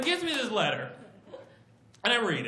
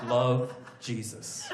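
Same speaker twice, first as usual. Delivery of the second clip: low, slow sign-off; understated, wounded letter ending; needy ex-girlfriend; 12 semitones lower, 3 dB quieter, much slower than usual. Acted. Jesus signing the first letter, quietly needy. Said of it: "sound like a really needy ex-girlfriend".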